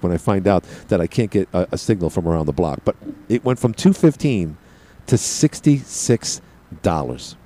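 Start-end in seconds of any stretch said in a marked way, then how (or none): 5.1-7.4 drawn-out slow reveal of the price; slow dramatic reveal. Real, not acted. Telling viewers how much his bill dropped, slow and drawn out. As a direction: let the number land slowly.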